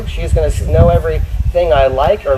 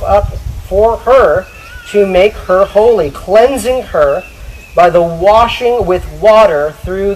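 emphatic, raised Bible reading with drawn-out key phrases; Scripture reading; 4 semitones higher, 3 dB louder, slower than usual. Acted. Preacher reads husbands their duty, emphatic Scripture reading. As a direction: proclaim the verse to the congregation.

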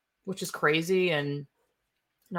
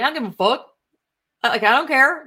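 mock dismissive shout; 7 semitones higher, 6 dB louder, faster than usual. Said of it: "they're like".

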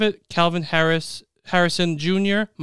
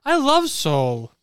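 extra loud; 8 semitones higher, much slower than usual. Acted. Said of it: "Extra loud for Soul".

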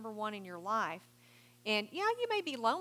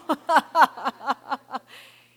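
laugh; 5 semitones higher, 16 dB louder than usual. Real, not acted. Laughs after the mock prayer.

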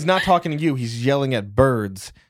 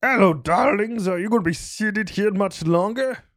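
campy drag queen; 8 semitones higher, 6 dB louder than usual. Acted. Old man character voice.

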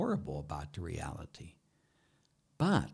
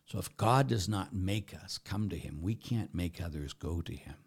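usual speaking voice

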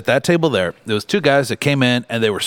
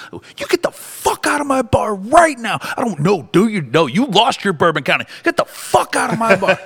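screaming; 11 semitones higher than usual. Retold or imitated. Bar owner screaming a rude customer out of his bar. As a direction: yell him out of the bar, full lungs. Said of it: "I'm screaming at the top of my lungs".

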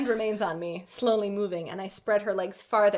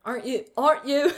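put-on British boyfriend voice; hammed-up accented question; 2 semitones higher than usual. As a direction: play a mock British heartthrob. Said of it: "nailing the adventurous adventures of One Direction [name] voice".

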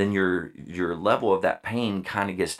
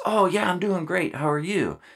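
much higher, chipper answer; upbeat reply; 11 semitones higher than usual. Retold or imitated. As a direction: sound genuinely fine and cheery. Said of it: "their typical reaction to that is".

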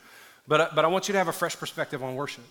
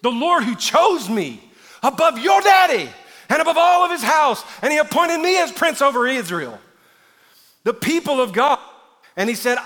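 high, full-voiced preacher's shout; defiant proclamation; 12 semitones higher, 11 dB louder than usual. David answering his wife's rebuke, shouting defiantly. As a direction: declare it boldly with stretched key words. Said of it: "I love David's attitude".

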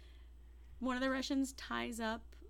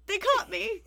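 mock frightened; 10 semitones higher, 12 dB louder than usual. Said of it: "so he's like".

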